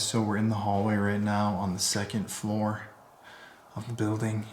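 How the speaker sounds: usual speaking voice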